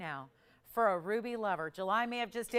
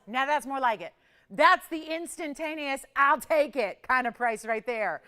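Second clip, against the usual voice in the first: normal talking voice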